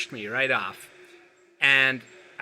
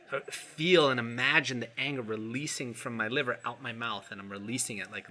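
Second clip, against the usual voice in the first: normal talking voice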